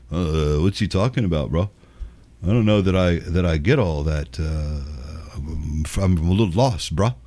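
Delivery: low, dopey confused bro; mocking a confused young viewer, low and dull; dumb bro voice. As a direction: drop low and play a lost bro. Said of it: "those people that watch that shit".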